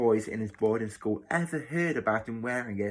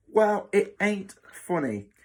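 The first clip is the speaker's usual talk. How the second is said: slow, higher-pitched protest; indignant Cockney; 9 semitones higher, 4 dB louder than usual. Acted. Boy objecting to matron's joke, indignant.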